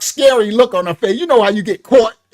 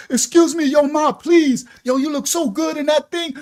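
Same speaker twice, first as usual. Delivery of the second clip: mock street catcall, pitched up, ending in a shout; catcalling dudes; 6 semitones higher than usual. Dudes at the store calling to her, mock catcall. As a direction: call out to a woman walking past.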